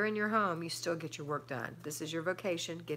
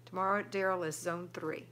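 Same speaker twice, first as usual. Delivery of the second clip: normal talking voice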